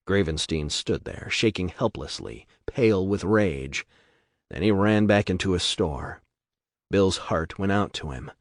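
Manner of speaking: ordinary delivery, nothing marked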